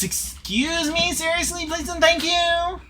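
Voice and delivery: high, slow mock-polite line; corrected catchphrase impression. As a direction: redo the catchphrase, stretched and prissy. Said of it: "wait, no, it's".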